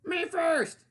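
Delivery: high, loud greedy piglet cry; pushy pig crying out. Acted. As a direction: shout it like a pig who must be first. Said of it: "he cried".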